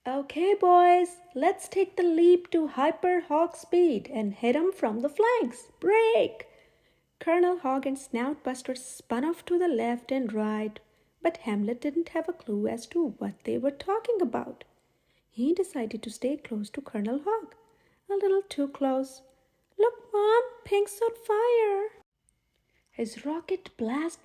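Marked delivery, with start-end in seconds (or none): 0.1-6.5 louder, higher battle orders; hammed-up heroic commander; commanding shout. A narrator's reading. Colonel commands attack. 19.8-21.9 higher and louder whoop, slow; a kid showing off mid-flight; thrilled piglet shout; excited yell. A narrator's reading. Hamlet's wild rocket flight.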